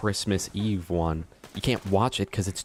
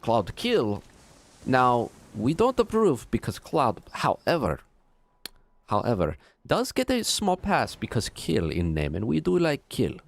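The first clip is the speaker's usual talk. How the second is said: put-on Russian accent; 2 semitones higher than usual. Streamer ranting against clouds as Vladov, in a Russian accent. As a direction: rant like a Russian henchman in a thick accent. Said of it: "fine to do an accent?".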